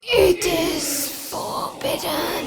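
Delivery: high, very slow, distant intonation; ominous warning. Acted. Guardian warning intruders away, slow ominous intonation.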